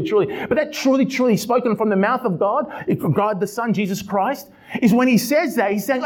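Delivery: high, urgent preaching voice. Acted. Pastor stressing the double truly, fervent preaching. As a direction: press the point home with fervour.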